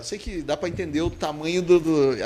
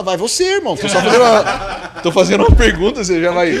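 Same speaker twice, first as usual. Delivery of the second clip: exasperated retort; 3 semitones higher, 6 dB louder, faster than usual. Retold or imitated. Imagined retort to bus driver.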